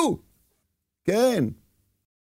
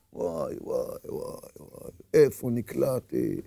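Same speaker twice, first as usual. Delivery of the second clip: frightened whisper; 11 semitones lower, 10 dB quieter than usual. Acted. Man in forest hears lion.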